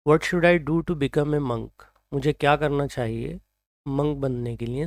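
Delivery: ordinary delivery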